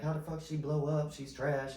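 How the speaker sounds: mildly indignant mock griping; retold complaint with a touch of irritation; mad haters. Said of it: "people be getting mad".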